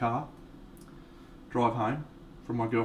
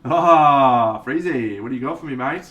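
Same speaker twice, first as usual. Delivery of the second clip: loud excited greeting; 2 semitones higher, 10 dB louder, slower than usual. Real, not acted. Greeting a viewer's song request.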